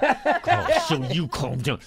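ordinary talk

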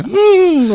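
high, loud hummed giggle; character giggle. Shown as a demonstration. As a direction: let out an odd high "mm" giggle. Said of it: "the giggle and the".

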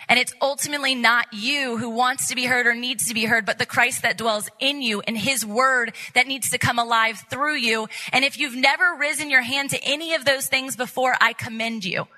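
ordinary delivery

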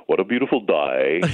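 light put-on Australian accent; mock Australian accent. Broadcaster joking about Aussies, hint of Australian accent. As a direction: tease in a playful Australian accent. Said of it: "I don't think I'll make fun of their accent".

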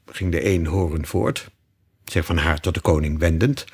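ordinary delivery, nothing marked

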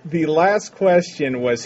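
normal talking voice

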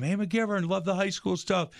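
high-pitched retold holler, no real volume; mock yell. Fan hailing the host across a gym, mock yell. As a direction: imitate someone calling out across a gym. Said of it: "they'll yell from across the gym".

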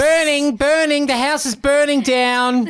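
high, slow alarm wail; panicking fire alarm. Acted. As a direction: cry it out like a frantic alarm.

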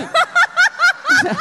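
very high laughing burst; laughing hard at the embarrassing memory; laughing squeak. Real, not acted. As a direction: crack up mid-sentence. Laughing at his own story.

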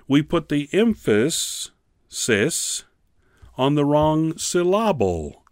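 "emphasis" and "syllable" mispronounced with the stress on their middles; misplaced stress. Retold or imitated. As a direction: stress emphasis wrongly as em-FAS-is, syllable as sil-LAB-le. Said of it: "as Mister Mason, who used to teach".

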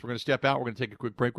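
ordinary talk

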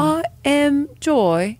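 high, very slow British affirmation; British accent. Shown as a demonstration. Trying an affirmation on the co-host, British accent. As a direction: stretch it out in tentative British. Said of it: "in a British accent".

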